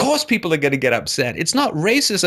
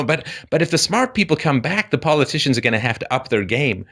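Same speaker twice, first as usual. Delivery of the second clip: ordinary talk, nothing marked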